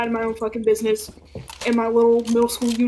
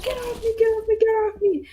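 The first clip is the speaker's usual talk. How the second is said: much higher, frantic pleading; panicked girl; 12 semitones higher than usual. Retold or imitated. Girl in arm lock begging, panicky high. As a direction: struggle free in panic. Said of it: "She's like".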